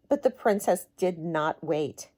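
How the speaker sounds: ordinary delivery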